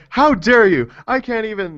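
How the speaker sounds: mock outrage. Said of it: "He's, like, on the phone".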